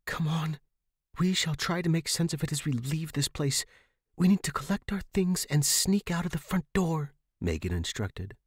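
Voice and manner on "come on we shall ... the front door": higher, calm instructions; leading young friend. Acted. Megan instructs.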